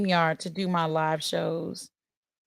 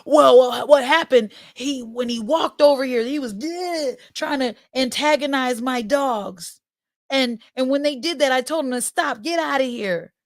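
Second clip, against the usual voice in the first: lightly higher, flustered and stumbling; stumbling neighbor; 5 semitones higher than usual. Retold or imitated. The neighbor stumbling over his words to the mother, mildly higher. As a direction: fumble for an excuse, a bit higher. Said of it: "now they're stumbling over their words".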